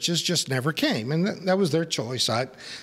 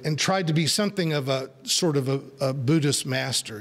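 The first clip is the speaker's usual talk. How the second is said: usual speaking voice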